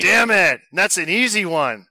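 frustrated outburst. Real, not acted. Host missing an easy quiz question, frustrated. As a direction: blurt it out in frustration.

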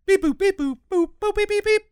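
high chirpy beep boop noises; imitated dial beeps. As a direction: beep like a phone dialing. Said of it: "the kid's like".

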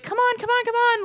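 high, repeated urging; coaxing, goading. Retold or imitated. Owner trying to get a dog in, coaxing. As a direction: wheedle a reluctant dog indoors. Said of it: "somebody just trying to get him in".

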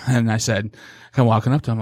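ordinary delivery

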